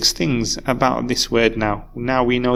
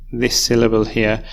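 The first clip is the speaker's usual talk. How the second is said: ordinary delivery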